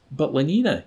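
object, pulling back from her; protesting. A narrator's reading. Savage protesting as Lenina lets go of him. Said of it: "he began protesting".

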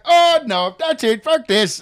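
loud, high mock outrage; fed-up outburst. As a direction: explode as if you've had enough.

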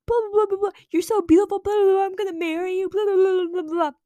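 high, loud imitation of his gushing; mock lovestruck babbling. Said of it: "he's, like, talking about".

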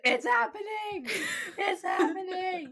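high, slow, ecstatic cry; excited. Retold or imitated. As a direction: squeal it with delight. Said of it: "I was like".

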